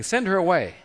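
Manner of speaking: voicing fed-up followers urging their master; insistent demand. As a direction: push it out as a pressing demand. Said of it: "kept asking him, saying".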